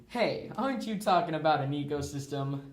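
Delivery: ordinary delivery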